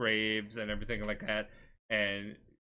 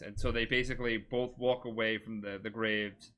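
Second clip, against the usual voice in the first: ordinary talk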